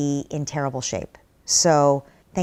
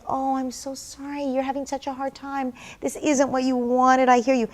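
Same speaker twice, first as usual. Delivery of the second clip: soothing comfort; 6 semitones higher than usual. Shown as a demonstration. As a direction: coo sympathy to a sad little one. Parent comforting a crying child.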